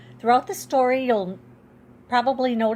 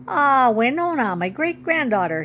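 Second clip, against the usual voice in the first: plain reading voice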